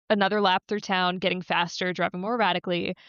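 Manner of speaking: ordinary talk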